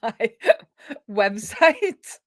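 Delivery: slow, laughing plea. Real, not acted. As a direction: giggle through it, mock pleading. Laughing at the debugger.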